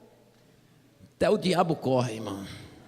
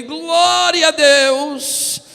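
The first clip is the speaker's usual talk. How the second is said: very slow, higher, loud stretched praise; drawn-out praise shout; 9 semitones higher, 12 dB louder, much slower than usual. Retold or imitated. Joining the crowd with a stretched loud praise. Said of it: "E eu levantei".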